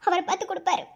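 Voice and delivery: ordinary talk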